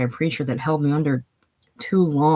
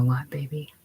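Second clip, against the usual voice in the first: whisper; 6 dB quieter than usual. Acted. Soft bedside question to Lot.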